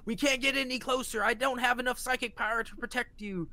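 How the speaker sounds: lightly voiced worried ally; high character voice. Voicing Serizawa.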